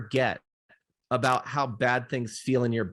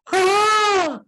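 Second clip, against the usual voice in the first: sudden high yelp of alarm; startled scream; 8 dB louder than usual. Retold or imitated. Grandmother reacting to toddler's fall, startled shriek. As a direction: shriek as if the child were hurt. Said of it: "my mom goes".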